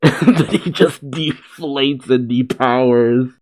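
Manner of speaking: ordinary delivery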